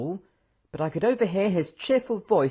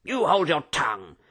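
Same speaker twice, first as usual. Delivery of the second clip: growled order; 3 semitones higher than usual. A narrator's reading. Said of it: "the general growled".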